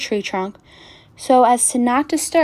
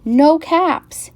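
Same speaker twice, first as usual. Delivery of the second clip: high, louder exclamation of dismay; alarmed discovery; 7 semitones higher, 5 dB louder than usual. A narrator's reading. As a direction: exclaim it, pitched well up. Looks for his missing caps.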